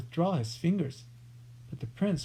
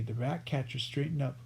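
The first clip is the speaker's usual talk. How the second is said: ordinary delivery, nothing marked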